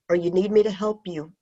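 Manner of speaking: ordinary delivery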